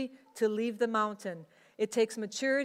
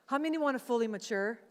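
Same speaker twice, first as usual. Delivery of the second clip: ordinary talk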